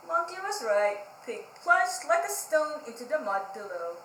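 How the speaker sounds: put-on lively storybook read-aloud for kids; reading to children. A narrator's reading. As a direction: read it like library story time, bright and engaged. Reads picture book to children.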